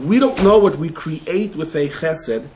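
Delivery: normal talking voice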